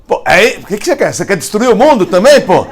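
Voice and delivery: high, loud complaint with a laugh; exasperated shout. Retold or imitated. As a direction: throw up your hands at the flood. Sage scolds God about flood.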